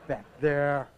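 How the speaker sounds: ordinary talk, nothing marked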